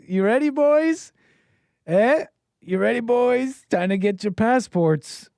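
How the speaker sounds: high, loud, drawn-out rallying cry; rally the boys, stretching every word; mock hype call. Mockingly hyping up male viewers.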